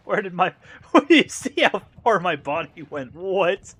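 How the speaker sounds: high, loud laughing disbelief. Real, not acted. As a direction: laugh through the words. Body flew far.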